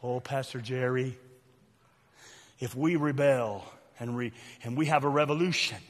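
soft, low, measured pleading; mock church member. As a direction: gently voice a hopeful congregant, low and subdued. Voices naive hopeful church member.

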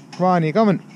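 loud, high call across the field. Real, not acted. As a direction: shout for your mates to come. Calling out to mates.

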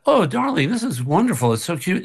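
high, fawning 'oh, darling'; hammed-up sweet flattery; mock gushing praise. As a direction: gush as if humoring a loved one. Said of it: "do you want the".

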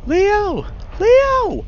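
full-out, high-pitched scream for help; desperate, very loud cry; panicked scream. A narrator's reading. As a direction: scream the name as loud as you can. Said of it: "I scream as loud as I can".

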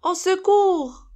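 high, loud, drawn-out call for help; storybook alarm at full voice; cry for help. A narrator's reading. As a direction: cry out as little pigs in danger. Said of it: "crièrent les petits cochons".

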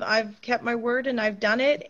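ordinary talk, nothing marked

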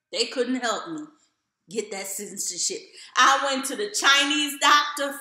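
ordinary delivery

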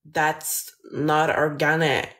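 put-on slow American girly voice; drawn-out American-accent demo. Shown as a demonstration. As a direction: say it slowly in an exaggerated American accent. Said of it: "with an American accent".

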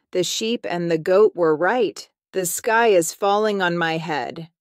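ordinary delivery

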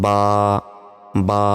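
বা drawn out for one second; held one second. Shown as a demonstration. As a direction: stretch বা for one beat. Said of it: "এক second টেনে পড়তে হবে". (বা এক সেকেন্ড টেনে)

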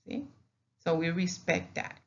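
ordinary delivery

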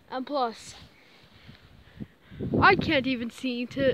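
ordinary delivery

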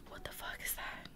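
whispered disbelief, very soft; hushed reaction. Retold or imitated. Storyteller reliving waking with something behind them, whispered. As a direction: whisper it as if to yourself. Retold frightened reaction during sleep paralysis.

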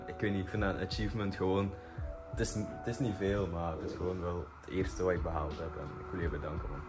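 ordinary talk, nothing marked

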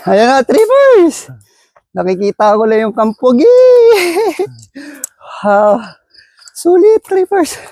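high, thrilled shouts at the view; excited. Real, not acted. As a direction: cheer at the summit, amazed. Reaching the summit view.

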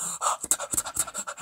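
quiet, breathy mock gasping; imitated gasps. Narrator imitating his hyped listeners, mock gasps. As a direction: gasp like a hooked audience. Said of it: "People like".

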